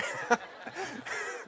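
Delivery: high-pitched amused laugh. Real, not acted. Laughs at ceiling joke.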